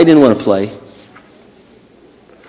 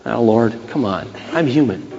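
low, soft pleading; quiet, weary objection, acted; subdued protest; 4 semitones lower, 8 dB quieter than usual. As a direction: plead weakly for a little slack. Acted plea back to God.

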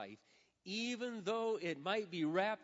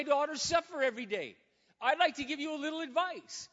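higher, louder griping at God; grumbling complaint; 7 semitones higher, 6 dB louder than usual. Retold or imitated. A father complaining to the Lord about his daughter, higher and louder. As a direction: air your grievances, voice up. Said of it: "grumbling and complaining".

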